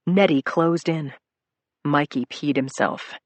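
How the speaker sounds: plain reading voice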